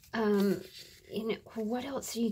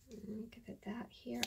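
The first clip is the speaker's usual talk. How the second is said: soft unvoiced whisper, then quicker; 2 semitones higher, 13 dB quieter than usual. Real, not acted. Crafter whispering to herself while gluing, a soft whisper. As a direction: whisper to yourself as you work.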